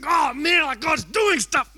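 high, loud excited shout, slowing on the last words. Retold or imitated. Preacher mimics himself to youth leaders, excited shout. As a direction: blurt it out, bursting with excitement. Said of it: "I'm just like".

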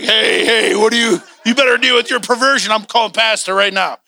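mock-alarmed husband scolding his wife; playfully indignant threat; jealous husband. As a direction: act out a jealous warning, pitch rising. Acts out jealous husband warning wife.

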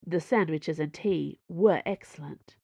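ordinary delivery

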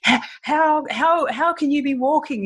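overwhelmed doctor, mildly tearful retelling; tearful disbelief. Retold or imitated. Doctor in tears at seeing his patient walk, tearful disbelief. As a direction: imitate stammering, high-pitched astonishment. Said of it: "he's in tears".

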